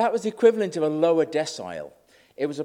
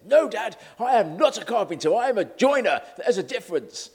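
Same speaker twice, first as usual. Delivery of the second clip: higher, louder indignant correction of Dad; protesting son; 6 semitones higher, 5 dB louder than usual. Retold or imitated. Son correcting his dad about his trade, protesting. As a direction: put your foot down, loud and high. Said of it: "but he says".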